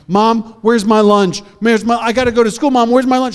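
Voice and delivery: put-on high, insistent child asking for lunch; pleading kid. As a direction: nag mom for the lunch bag.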